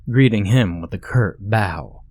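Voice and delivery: ordinary delivery, nothing marked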